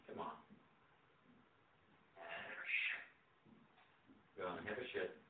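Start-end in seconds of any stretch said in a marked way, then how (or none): none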